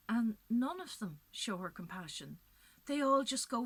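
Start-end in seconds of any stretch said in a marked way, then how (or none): none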